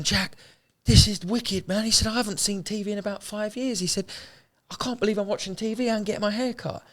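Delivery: high, excited voice of a man overjoyed at a haircut; amazed delight. Retold or imitated. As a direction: gush with disbelief like a kid at a treat. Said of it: "And he was going".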